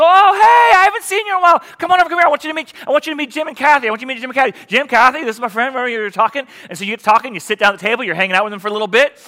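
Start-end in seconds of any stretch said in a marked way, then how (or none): 0.0-6.5 high, bright and loud, calling a friend over; cheery friendly greeting. Acted. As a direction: greet an old friend with delight, much higher. Acting friendly restaurant greeter.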